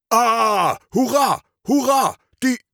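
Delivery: high hurrah war cry in another voice; battle roar. Acted. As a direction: cheer the charge like a fighter. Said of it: "Sofort kommt Kampfgebrüll auf".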